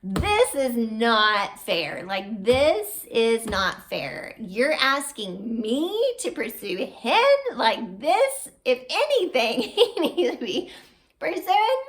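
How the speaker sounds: high, loud, slow indignant complaint; retold angry protest. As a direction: protest it like it's unfair, half laughing. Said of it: "And so I got angry".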